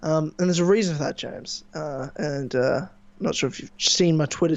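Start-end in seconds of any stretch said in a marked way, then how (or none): none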